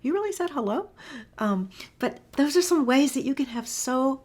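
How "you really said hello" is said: high, surprised question; a dog double-taking at you; amazed dog. Retold or imitated. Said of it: "they'll come back and go like".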